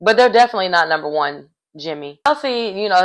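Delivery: usual speaking voice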